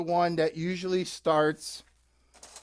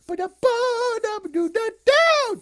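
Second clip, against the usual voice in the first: flute imitation; 5 dB louder than usual. Dog playing a flute.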